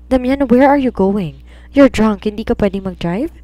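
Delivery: loud, pleading call to Damian; worried shout at a drunk friend; shouting after him. Acted. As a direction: raise your voice to stop him. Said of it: "Sigaw niya rito".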